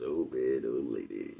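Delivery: low, quiet imitation of the nephew; lightly voiced nephew, low and soft; imitating nephew. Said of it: "Nephew says".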